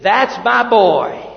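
put-on slow, loud "That's my boy" with pride; proud loud declaration. Preacher voicing God as a proud father, slow and loud. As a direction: announce it like a proud father, drawn out. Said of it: "God makes a statement".

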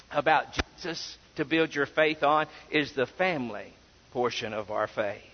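normal talking voice

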